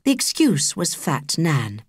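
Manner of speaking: ordinary delivery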